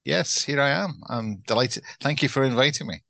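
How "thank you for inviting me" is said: ordinary talk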